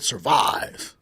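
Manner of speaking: low, flat movie-line delivery; deadpan one-word command from the film; mock movie quote; quoting Tropic Thunder. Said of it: "that scene in Tropic Thunder where bro said".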